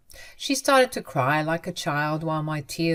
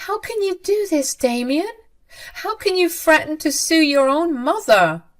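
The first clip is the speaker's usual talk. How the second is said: much higher, louder begging mother's voice; desperate pleading mother; 10 semitones higher, 4 dB louder than usual. Acted. Mother begging Damien and his wife Winter, high desperate plea. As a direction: plead frantically for forgiveness.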